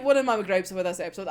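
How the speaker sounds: ordinary talk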